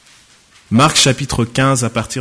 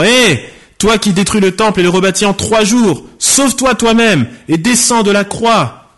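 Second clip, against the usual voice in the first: high, raised heckling from a crowd; jeering taunt; 8 semitones higher than usual. Acted. Passers-by insulting the man on the cross, jeering. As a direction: call out a scornful challenge, pitched well up. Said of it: "Les passants blasphémaient contre lui et secouaient la tête".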